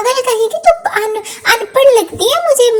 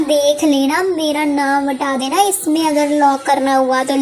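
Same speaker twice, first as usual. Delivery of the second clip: low-voiced partner, giving in; 8 semitones lower than usual. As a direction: back down grudgingly, low. Low voice conceding quietly.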